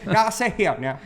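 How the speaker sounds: laughing Southern judge order. Acted. Mock Southern courtroom bit.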